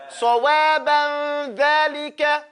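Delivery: sawaban dhalika drawn out slowly; slow teaching demo. Shown as a demonstration. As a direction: model it slowly for the class. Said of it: "Ba fatha ba".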